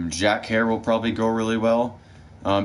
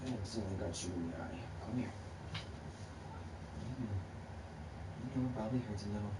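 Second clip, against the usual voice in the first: much softer, hushed comforting of a pet; soft soothing; 18 dB quieter, faster than usual. Real, not acted. To her dog Luce, hurt in the eye, hushed soothing apology. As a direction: murmur sorry to the hurt dog.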